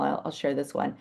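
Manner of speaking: usual speaking voice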